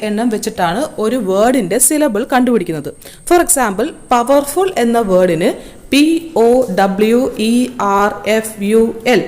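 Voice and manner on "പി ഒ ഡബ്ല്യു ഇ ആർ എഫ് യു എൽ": letter by letter, evenly separated; spelling out. Shown as a demonstration. Teacher counting the letters in a word, spelling it out. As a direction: spell it out one letter at a time. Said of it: "എട്ട് letters ആണ് ഉള്ളത്".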